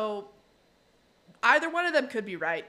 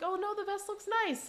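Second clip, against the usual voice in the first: nervous flattery; 12 semitones higher than usual. Retold or imitated. Bob flatters the biker.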